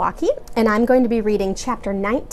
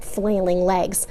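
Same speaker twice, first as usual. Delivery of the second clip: plain reading voice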